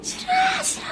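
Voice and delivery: put-on high, wailing toddler's no; crying child's refusal. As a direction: whine it like a small child in tears. Mother imitates crying child refusing.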